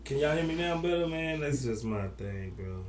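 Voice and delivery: muffled, like underwater. Real, not acted. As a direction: sound like talking from a fishbowl. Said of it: "you sound like you're underwater".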